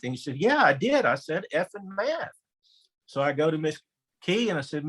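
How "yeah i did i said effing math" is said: put-on higher, innocent kid voice; a third grader proudly owning up; lightly voiced earnest little boy. Third grader admits to principal.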